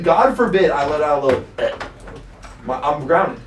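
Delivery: ordinary talk, nothing marked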